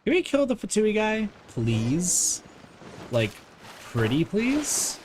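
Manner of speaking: ordinary delivery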